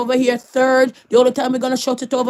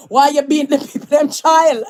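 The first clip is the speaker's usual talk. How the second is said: louder scold cracking into laughter; laughing scold; 3 semitones higher, 5 dB louder than usual. Real, not acted. Scolding the woman who hit the child, laughing. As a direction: scold her while laughing.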